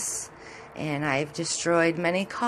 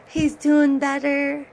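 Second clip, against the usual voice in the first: higher, louder, drawn-out happy announcement; happy news; 7 semitones higher, 4 dB louder, slower than usual. Real, not acted. Owner telling viewers her cat Simba is recovering, delighted. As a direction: share the good news with delight.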